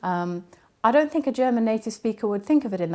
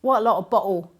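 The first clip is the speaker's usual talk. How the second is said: louder Cockney with "bottle" glottal-stopped; Cockney accent; 7 dB louder than usual. Acted. Linguist imitating a Cockney speaker, Cockney glottal stops. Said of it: "listen to someone talking Cockney".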